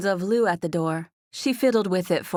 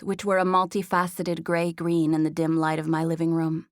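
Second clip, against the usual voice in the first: ordinary delivery, nothing marked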